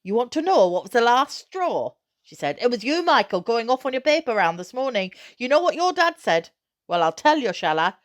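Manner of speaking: high, loud furious scolding; angry mother confronting her son; steaming angry. A narrator's reading. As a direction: let the anger boil over at him. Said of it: "She became steaming angry then".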